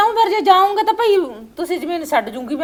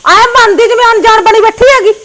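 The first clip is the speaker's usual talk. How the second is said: loud sarcastic scolding; staged anger; 3 semitones higher, 7 dB louder than usual. Pretend. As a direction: taunt her loudly for show. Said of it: "ਗੁੱਸੇ ਦਾ ਨਾਟਕ ਕਰ ਦੇ". (ਤਾਨ੍ਹੇ ਵਾਲਾ ਗੁੱਸਾ)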